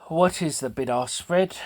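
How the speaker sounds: breathless after a workout; catching breath between words. Real, not acted. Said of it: "Sorry, I seem out of breath".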